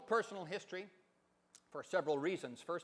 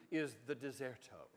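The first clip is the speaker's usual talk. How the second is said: put-on half English accent; 5 semitones lower, 5 dB quieter than usual. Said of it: "speaks with a half English accent, sort of like David Niven".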